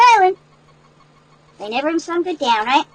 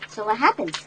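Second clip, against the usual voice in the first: usual speaking voice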